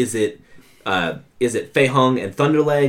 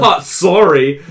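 mock "sorry", higher and louder; apologetic impression; 4 semitones higher, 5 dB louder, slower than usual. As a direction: imitate a harmless guy apologizing, laughing through it. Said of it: "That was got great impression".

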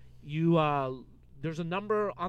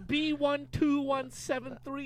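slow, slightly higher robotic read; Star Wars android; 7 semitones higher, slower than usual. Acted. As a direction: read the digits like a droid, slowly. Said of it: "You sound like a Star Wars android".

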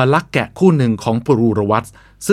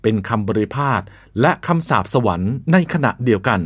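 ordinary delivery

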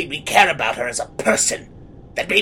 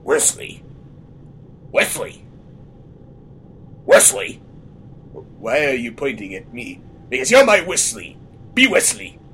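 Fisk impression; 5 semitones lower than usual. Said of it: "It, it was like".

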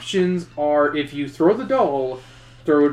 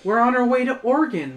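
higher, patient mother; a hint of a gentle mother; lightly voiced mother; 8 semitones higher than usual. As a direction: explain it gently to a confused child. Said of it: "the woman says".

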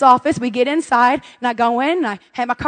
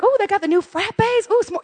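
tempted delight; 7 semitones higher than usual. Retold or imitated. Mom spotting new frappes, high and excited. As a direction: coo over it, pitched high. Spots new drinks at Starbucks.

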